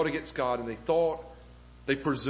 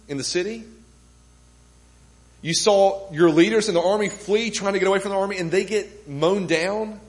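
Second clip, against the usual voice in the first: louder, higher preaching as he paints the siege; rising sermon intensity; 4 semitones higher, 5 dB louder than usual. Acted. Pastor to congregation, painting the siege, louder and higher. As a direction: build the scene with mounting force. Preacher builds to full force.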